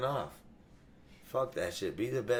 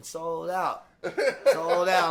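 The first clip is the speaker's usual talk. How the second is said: mimicking the promoter; 6 semitones higher, much slower than usual. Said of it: "promoter ... going".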